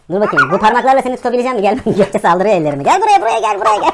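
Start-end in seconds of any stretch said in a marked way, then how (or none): 2.8-3.9 playful calling. Real, not acted. Playful chase with balloon hands.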